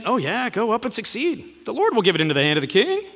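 sarcastic echo with swooping pitch; sneering, insincere going-along. Shown as a demonstration. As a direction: toss it off as mock agreement, rushing the end. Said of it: "Micaiah speaks sarcastically".

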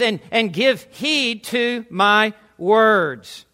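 slow, weighty delivery, drawing out each word; slow commanding quote. Acted. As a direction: command their attention word by word. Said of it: "he is commanding".

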